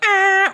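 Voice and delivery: high, loud held horn blare; high train horn. Retold or imitated. Showing the train horn's high setting, high held honk. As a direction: imitate a horn on its high note. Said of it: "you can go high or low".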